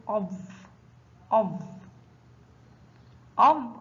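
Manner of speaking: أظ closed on a heavy ẓa, no vowel after; letter with sukun. Shown as a demonstration. Teacher shows ẓa with sukun, أظ as a closed syllable. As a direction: say أظ as one firm isolated syllable. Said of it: "حرف الظاء فوقه سكون ينطق".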